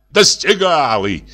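mildly shouted comeback at his son; angry shout. A narrator's reading. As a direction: throw it back angrily, drawn out. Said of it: "закричал и на него отец".

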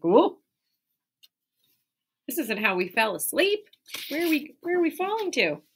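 a mild bewildered cry as they wake up; surprised characters. Acted.

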